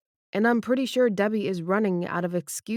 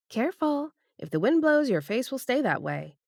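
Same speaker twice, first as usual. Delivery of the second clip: lightly raised female voice; Debbie's voice; 8 semitones higher than usual. Acted. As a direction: voice the woman, a bit higher. Debbie voiced by male narrator.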